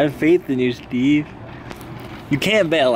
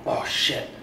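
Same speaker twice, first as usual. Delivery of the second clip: ordinary talk, nothing marked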